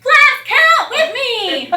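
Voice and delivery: high, loud, slow shouted command; loud child's shout. Retold or imitated. Shy boy leading his class, shouting with joy. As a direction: belt it out at the top of his lungs. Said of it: "the loudest he can speak".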